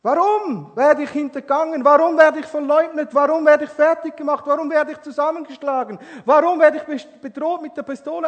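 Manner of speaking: high, loud, fast pleading questions; anguished shouting. Retold or imitated. Young man on the phone, anguished shouting. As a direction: hurl each why in pain.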